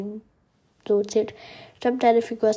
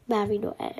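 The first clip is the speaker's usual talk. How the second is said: ordinary talk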